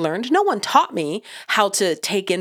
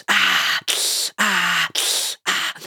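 hissed breath in, then a drawn-out "ah"; imitated pain groans; 2 semitones lower, much slower than usual. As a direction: mimic a cartoon hurt-knee gag, sucking air before each ah. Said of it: "that Family Guy joke".

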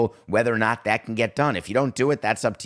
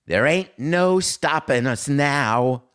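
slow, higher and louder gloating; mock triumph; 6 semitones higher, 4 dB louder, much slower than usual. Host mocking leagues as unstoppable, slow gloating. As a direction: proclaim it grandly, stretched out.

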